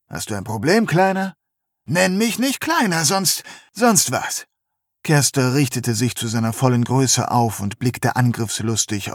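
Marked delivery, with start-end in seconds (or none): 0.1-1.3 herausfordernd. Acted. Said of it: "Kersters Blick verfinsterte sich". 1.9-4.5 gereizt. Acted. Drayton streitet gereizt.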